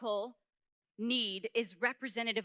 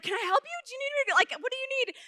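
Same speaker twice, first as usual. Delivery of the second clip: high, fussing offer of help; concerned neighbor; 13 semitones higher, 4 dB louder, much faster than usual. Retold or imitated. Missus Gregory to the hurt child, anxious concern. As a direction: imitate a worried neighbor, pitched up.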